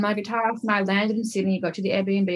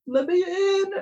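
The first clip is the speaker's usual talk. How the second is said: pleading cry; 11 semitones higher, slower than usual. Retold or imitated. Singer pleading to release songs.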